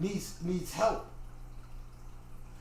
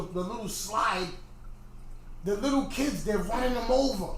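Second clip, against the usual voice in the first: usual speaking voice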